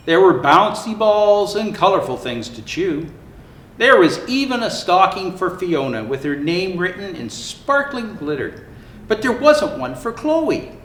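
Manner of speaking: plain reading voice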